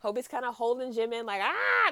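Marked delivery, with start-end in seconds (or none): 1.4-1.9 retold scream. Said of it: "They're, like, screaming".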